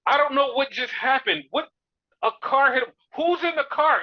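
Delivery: mock announcer shock; excited commentator. Commentator reacting to the car being hit, excited. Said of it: "one of the commentators says".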